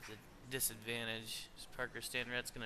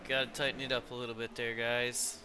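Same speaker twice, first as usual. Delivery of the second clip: louder, faster race call; live play-by-play; 5 dB louder than usual. Acted. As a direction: call the restart with rising excitement.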